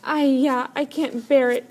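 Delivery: put-on the baby brother near tears; crying out in distress. A narrator's reading. Seventh brother, pitying the hungry men, cries out. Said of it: "cried seventh brother".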